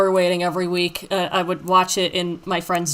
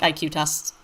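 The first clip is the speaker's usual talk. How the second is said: normal talking voice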